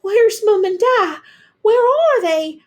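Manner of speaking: high, loud frantic cry; panicked cry. A narrator's reading. Girl searching for her parents in the fire, panicked cry.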